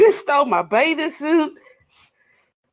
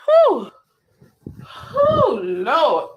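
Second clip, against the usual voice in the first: exasperated whew; much slower than usual. Real, not acted. Venting exasperation.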